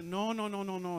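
hasty denial. Retold or imitated. Preacher voices mom explaining she was only praying, hasty denial. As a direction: wave off the misunderstanding. Mom hastily correcting the misunderstanding.

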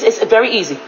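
normal talking voice

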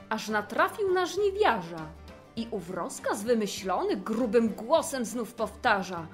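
ordinary delivery

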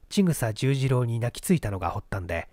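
ordinary delivery, nothing marked

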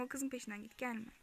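usual speaking voice

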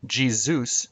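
Jesus slurred into jee-ZOOS; slurred to rhyme. Shown as a demonstration. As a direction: stretch Jesus slowly so it ends like Zeus. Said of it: "Only if you slur the word".

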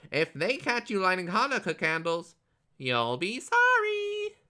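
very high, loud warning from a little goblin; squeaky goblin. A narrator's reading. Little goblin warns Herschel of bigger goblins, squeaking. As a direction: pipe up shrilly, stretching the last threat. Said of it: "he squeaked".

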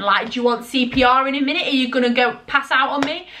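ordinary talk

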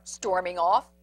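ordinary delivery

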